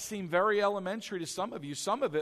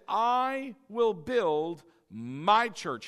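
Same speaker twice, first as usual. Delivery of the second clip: slow, weighty "I will build my church"; emphatic quote; 3 dB louder, much slower than usual. Retold or imitated. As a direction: stretch each word of "I will build my church". Honing in on key quote.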